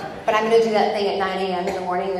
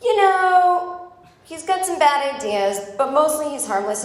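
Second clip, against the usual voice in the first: mock guide voice; 8 semitones higher, 4 dB louder than usual. Quoting the dismissive museum guide.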